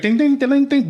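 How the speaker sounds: high loud imitation of a truck bell; ding ding chime. Imitating the ice cream truck bell, high and loud. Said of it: "it's like".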